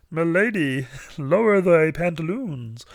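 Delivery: high, slow cartoon alien voice with mock formality; Marvin the Martian. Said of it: "in the voice of Marvin the Martian".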